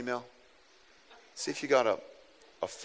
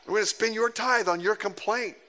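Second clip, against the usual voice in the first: raised, mock-indignant thought; a sarcastic jab at a complaining member; sarcastic inner retort; 7 semitones higher than usual. Pastor's silent comeback, raised and mocking. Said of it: "I thought".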